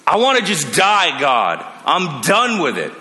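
despairing Jonah. Retold or imitated. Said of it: "Jonah's anger and hopelessness come roaring back".

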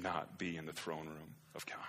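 hushed whisper of awe. Real, not acted. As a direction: drop to a breathless whisper. Preacher drops to whisper.

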